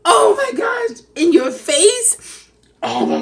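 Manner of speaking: high, loud laughing near tears; begging him to stop through laughter; shrieking with laughter. Real, not acted. Laughing at the gas story.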